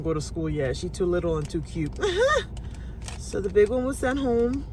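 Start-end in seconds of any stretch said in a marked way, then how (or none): 2.0-3.1 light chuckle. Real, not acted. Joke about cute little one.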